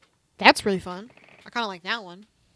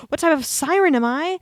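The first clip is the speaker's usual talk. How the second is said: excited; 7 semitones higher, 7 dB louder than usual. Real, not acted. Excited quiz question.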